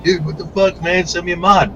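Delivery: high, whiny imitation of a begging fan; mock whiny fan. Fans begging him online for a free mod, whiny and high. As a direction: mimic a pushy fan asking for freebies. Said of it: "people are just like".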